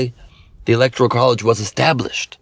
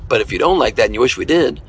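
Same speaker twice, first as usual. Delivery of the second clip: ordinary talk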